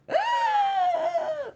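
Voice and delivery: long high-pitched fangirl scream; excited squeal. Retold or imitated. Host reenacting her reaction to the show, excited squeal. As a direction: let out a loud, sustained, piercing squeal. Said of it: "And I go".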